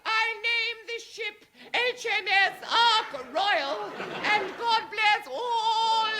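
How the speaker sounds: high, slow, posh ceremonial voice; ship launching speech. Acted. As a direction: christen the ship grandly. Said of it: "look the world in the face and say".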